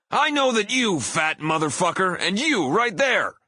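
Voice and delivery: very high, loud heel call-out; single out a heckler at full wrestler pitch; pro wrestler bellow. Retold or imitated. Singer calling out hateful guys in the audience, wrestler bellow. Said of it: "bellowed in my best professional wrestler voice".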